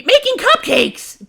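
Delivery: high, loud and drawn out; happy announcement. Acted. Pinkie reveals the plan to Dash, high and thrilled. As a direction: sing it out with delight. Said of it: "Pinkie happily announced".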